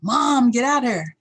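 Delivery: loud, high, drawn-out holler; imitated dad's yell. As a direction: mimic an impatient dad hollering down the hall. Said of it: "he's yelling".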